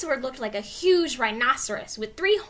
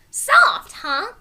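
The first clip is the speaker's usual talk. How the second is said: high, loud delighted cry; 10 semitones higher, 9 dB louder than usual. Acted. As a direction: gush with delight. Girl delighted, petting creature.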